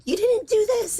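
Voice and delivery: high mock accusation; imitated yelling. Imitating neighbors' screaming quarrel.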